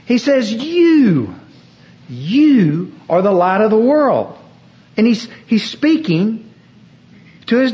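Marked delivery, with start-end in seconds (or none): 0.5-4.4 an emphatic You, as in the Greek; stress on YOU. Shown as a demonstration. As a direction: hit the word You hard. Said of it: "You in the original Greek language is emphatic".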